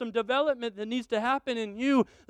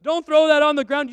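loud, raised firm order; stern dad; 6 semitones higher, 9 dB louder than usual. Retold or imitated. Father ordering his son to eat the whole strawberry, loud and firm. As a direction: lay down the law loudly. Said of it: "I said".

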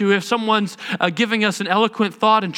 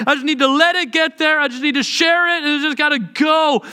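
venting inner voice; 5 semitones higher than usual. Retold or imitated. Voicing a raging person's inner thoughts.

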